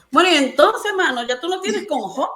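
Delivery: high, exasperated reproach with a laugh; complaining to God. Retold or imitated. Woman reproaching God about Job's trials, high exasperated voice. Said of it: "yo le reclamo y le digo".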